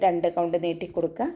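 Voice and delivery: ordinary delivery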